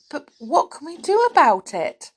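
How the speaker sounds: anxious pleading. Acted. Beth worrying about lost Connie, anxious. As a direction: beg the others for a plan. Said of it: "Beth went pale".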